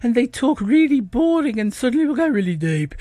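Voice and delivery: dull, droning Brummie impression; mock Brummie accent; Birmingham accent. Shown as a demonstration. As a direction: put on a boring Birmingham accent, sinking at the end. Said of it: "their accent's like that. It's really flat".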